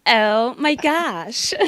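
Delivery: put-on drawn-out valley girl gasp. Shown as a demonstration. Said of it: "I can do, like".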